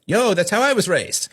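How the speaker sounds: high-pitched, hammed-up self-justification; mock defensive retort. Acts out parent's defensive retort.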